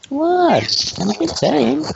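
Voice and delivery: squeaky, defensive, very high; high mock innocence. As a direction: protest it up high.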